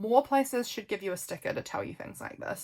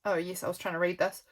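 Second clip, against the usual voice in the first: ordinary delivery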